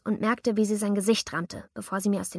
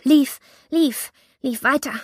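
breathless rush; 5 semitones higher, 9 dB louder, slower than usual. A narrator's reading. Breathless running narration.

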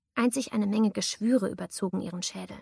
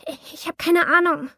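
higher and louder, scared and breathless; frightened girl denying everything at knifepoint; panicked denial; 8 semitones higher, 7 dB louder than usual. A narrator's reading. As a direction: blurt it out in fear.

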